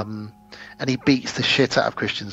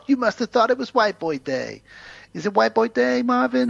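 higher, sneering taunt at Marvin; mocking taunt; 11 semitones higher than usual. Gangster taunting his captive, higher and mocking. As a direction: needle him in a raised mocking voice. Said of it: "and he says to him".

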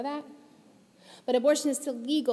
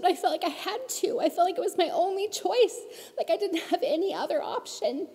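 real emotion pushing the quote louder; emotional, raised voice; 7 semitones higher, 4 dB louder than usual. Real, not acted. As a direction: let the feeling break through, louder and higher. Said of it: "I get so emotional".

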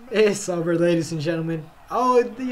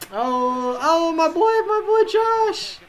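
slow, very high squeal; dismayed wail; 9 semitones higher, slower than usual. Real, not acted. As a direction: whine it high and drawn out. Lamenting his quarterback's play.

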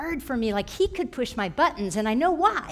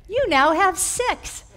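exasperated raised penalty; 8 semitones higher, 6 dB louder, slower than usual. Retold or imitated. Mom raising punishment in frustration.

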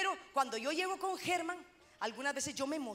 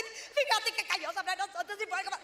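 excited gush; 6 semitones higher than usual. Retold or imitated. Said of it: "se le quita la emoción".

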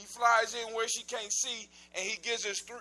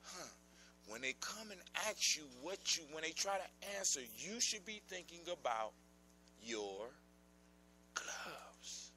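soft, low scheming witch, fading to a whisper on gloves; quiet sly old witch; 4 semitones lower, 12 dB quieter than usual. Acted. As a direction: hush it like a secret trick. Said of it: "She says".

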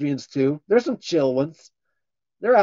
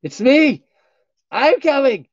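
shout; 11 semitones higher, 8 dB louder, slower than usual. Pretend. Loud answer to whisper bit.

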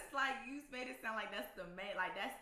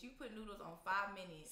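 normal talking voice

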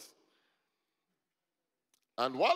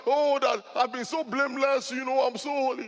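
loud, high parody of holiness; mock self-righteousness; 10 semitones higher, 9 dB louder than usual. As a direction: boast high and loud, mocking your own piety. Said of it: "it sounds like".

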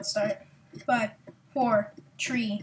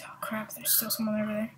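hushed whisper, no voice; 9 dB quieter than usual. Real, not acted. Gamer spotting an enemy nearby, whispering. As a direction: whisper so the enemy won't hear.

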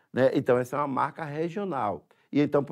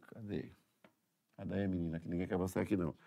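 much softer, murmured to someone nearby; quiet aside; 8 semitones lower, 11 dB quieter, faster than usual. Real, not acted. Teacher turns away from the lesson to murmur to someone nearby. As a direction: drop the voice and speak off to the side.

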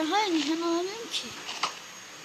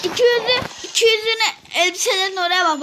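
insistent protest; 2 semitones higher, 4 dB louder than usual. Real, not acted. Child telling parent where the money is, loud insistent protest. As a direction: insist loudly, defending yourself to a parent.

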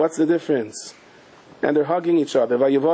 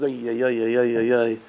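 mock cries of pain; hammed-up wail of pain; groaning; 3 semitones lower than usual. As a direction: moan under the blows. Being beaten, he groans.

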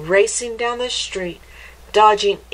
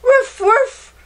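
high, loud playful woofs; dog barking; 6 dB louder than usual. Acted. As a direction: bark back happily like a dog. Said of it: "replied Scamp".

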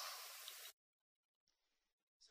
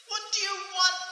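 much higher, loud delighted greeting; delighted king; 6 dB louder than usual. Acted. King welcoming the queen, high and loud.

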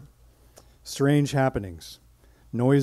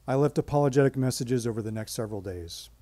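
ordinary delivery

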